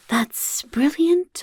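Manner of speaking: high, slow mock-delighted exclamation; eager praise. Goblin offering an eager answer option, mock delight. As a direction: gush it like a thrilled fan. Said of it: "would you say".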